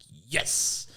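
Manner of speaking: a high, loud little cheer; triumphant yes. Retold or imitated. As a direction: celebrate the hit. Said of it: "I'm like".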